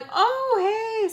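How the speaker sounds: very high, drawn-out hello; put-on greeting. Greeting the guy she has beef with, put-on high hello. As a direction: pitch it way up, imitating a greeting. Her greeting back to Joe.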